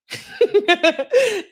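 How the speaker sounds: sudden high laugh; high burst of laughter. Real, not acted. Reacting to comment.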